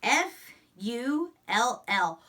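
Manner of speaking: letters spaced slowly and evenly; slow spelling out. Shown as a demonstration. Teacher spelling a sight word aloud slowly.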